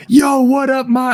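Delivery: loud, high greeting yelled through the door; high excited yell; retold shout. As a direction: burst in calling out to mom. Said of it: "he just shouts".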